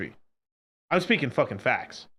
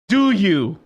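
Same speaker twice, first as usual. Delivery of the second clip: louder, pointed question; a firm, louder challenge; challenging question; 3 semitones higher, 5 dB louder than usual. Acted. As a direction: put it to them pointedly. First take of challenging question.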